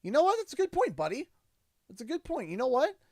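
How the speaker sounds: high, bright impressed praise, hammed up; playfully won over by his son's point; mock-impressed dad. Dad to son, warmly impressed in a high, bright mock-excited voice. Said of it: "I'm like".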